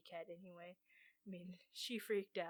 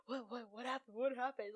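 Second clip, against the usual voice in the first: higher-pitched retold panic, words repeating; freaked out; 4 semitones higher than usual. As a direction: imitate a startled grandmother's frantic questions. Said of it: "she totally freaked out".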